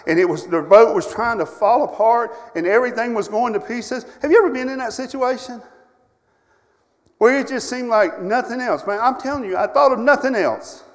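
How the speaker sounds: loud, heated storytelling that climbs in pitch; a preacher reliving his terror; preaching at full force. Acted. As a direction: pour it out to the pews. Preacher retells the storm and fear.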